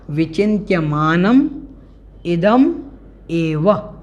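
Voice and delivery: विचिन्त्यमानम, इदम, एव with a clear pause after each; words separated. Shown as a demonstration. Teacher splitting the compound for students, paused विचिन्त्यमानम, इदम, एव. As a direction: break it into विचिन्त्यमानम / इदम / एव, each standing alone. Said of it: "इसको अलग अलग कर लेंगे".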